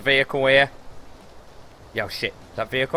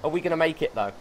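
normal talking voice

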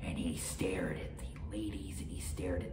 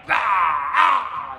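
loud, high pained yells; ogre's howl of pain; 16 dB louder than usual. A narrator's reading. Oni stabbed from inside, high howls of pain.

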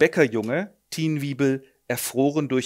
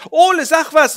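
high, loud urgent plea; a frightened woman pleading loudly; panicked shout; 14 semitones higher, 12 dB louder than usual. Acted. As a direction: shout at him to act.

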